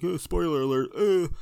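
loud, high mock alarm; mock spoiler warning. Mocking those who warn about spoilers, loud fake alarm. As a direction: blare it like a silly siren. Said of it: "I'm not even gonna be like".

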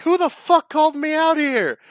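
very high, hammed-up demand; indignant character. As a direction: demand to know, building to a loud squeal. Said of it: "when she's like".

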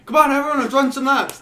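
high, loud coach yell; coach shout. Retold or imitated. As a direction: call the team out loud. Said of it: "coach is like".

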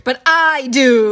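slow, loud, haughty put-on voice; pompous self-important drawl; mock arrogant Jekyll. Mocking Jekyll as an arrogant narcissist, slow haughty voice.

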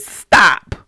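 loud, sharp holler; acted shout to halt someone; hollered command. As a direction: cut him off with a shout. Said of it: "Samuel hollered at him".

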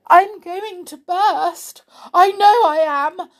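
high, drawn-out storybook rage; enraged. A narrator's reading. Missus Goodmanners threatens to burst, enraged. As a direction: wind up as if about to explode. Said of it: "She's getting angrier and angrier".

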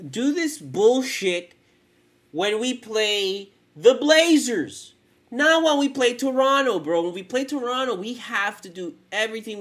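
loud, slow angry rant; angry shouting. Real, not acted. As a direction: yell it out in frustration. Ranting about playing Toronto.

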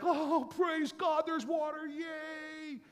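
high, slow, thrilled cry; a mock cheer at finding water; overjoyed. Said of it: "it's like".

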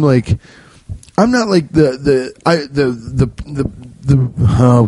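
ordinary delivery

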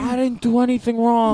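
high mock-innocent protest; Sebastian Maniscalco. Scolded talker denying any fault, high mock protest. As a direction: whine your innocence in a put-on voice. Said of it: "They turn into Sebastian Maniscalco".

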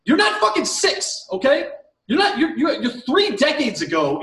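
fired-up, high-pitched rant; indignant telling-off; exasperated scolding. Real, not acted. Exasperated rant scolding gift peeker.

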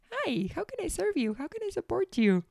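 mock sweet customer-service chirp; sugary helpful voice. Imitating the cheerful helper she wasn't, high and sweet. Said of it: "It was not like".

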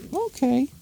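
very high falsetto okay; put-on wife voice; agreeing wife. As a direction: chirp agreement in a woman's falsetto.

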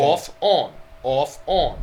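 "off" and "on" both with an aw vowel; "off, on" in matching aw sounds; same vowel demo. Shown as a demonstration. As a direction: say "on" like "awn" to match "off". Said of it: "it's the same exact sound".

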